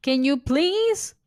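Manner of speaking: loud, high, drawn-out plea; begging. Real, not acted. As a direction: plead loudly, stretching the last word upward. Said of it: "I'm begging you".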